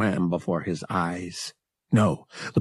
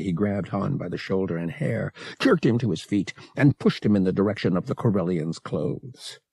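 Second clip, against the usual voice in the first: usual speaking voice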